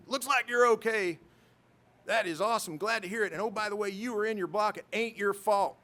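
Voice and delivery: high, loud Southern commander impression; Bo Marzoff, Southern accent. Commander reassuring his pilot, hearty imitated Southern accent. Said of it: "I don't have the best Southern accent".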